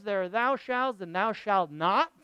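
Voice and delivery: ordinary talk, nothing marked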